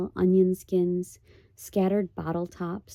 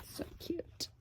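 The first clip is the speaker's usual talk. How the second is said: whispered aside; 9 semitones higher, 16 dB quieter than usual. Real, not acted. Aside about drawing.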